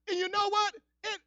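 normal talking voice

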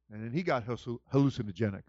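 usual speaking voice

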